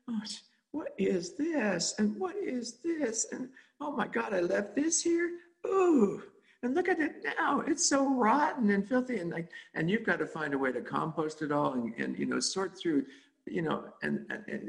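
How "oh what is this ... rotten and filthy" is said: put-on high, amazed cries at old junk, first words whispered; shocked disgust. Digging through the basement of the mind, high appalled discovery. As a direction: gasp at each rotten find in a raised voice. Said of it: "And you go like".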